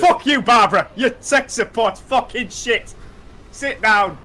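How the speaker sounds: loud, high game rage; worked-up ranting. Real, not acted. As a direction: bellow abuse at the enemy. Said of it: "you need to calm down".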